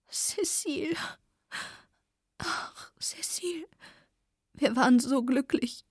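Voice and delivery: soft, tearful lament, breaking into a whisper on the name; hushed tearful sorrow; sad weeping. A narrator's reading. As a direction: cry it quietly, a friend lost. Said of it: "leise und traurig an zu weinen".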